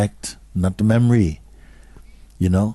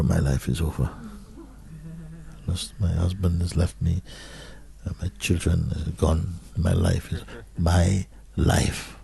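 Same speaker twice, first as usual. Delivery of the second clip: deflated lament; 8 dB quieter than usual. Retold or imitated. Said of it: "speaking from an emotional state".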